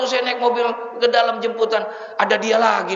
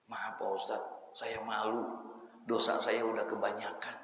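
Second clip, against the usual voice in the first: ashamed quiet confession; 11 semitones lower, 15 dB quieter than usual. Retold or imitated. Young man confessing his sins to the ustadz, softly. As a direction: confess your shame almost under your breath.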